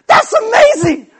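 hammed-up rejoicing at a tiny wiggle; over-the-top amazement. Friends cheering the revived man's finger, in a hammed-up shout. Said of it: "And they're all like".

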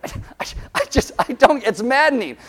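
lost for words, then blurt it out; exasperated sputter. Real, not acted. Speechless exasperation after retelling.

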